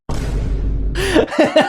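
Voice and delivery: high breathy laugh; real laughing fit. Real, not acted. Host cracking up at her own gross joke, real laughing fit.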